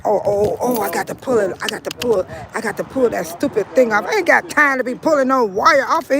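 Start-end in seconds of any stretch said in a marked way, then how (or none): none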